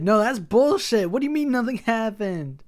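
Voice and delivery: high, loud furious protest; outraged yell. Real, not acted. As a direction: yell it at the screen. Outraged at nothing happening.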